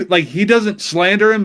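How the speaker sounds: ordinary delivery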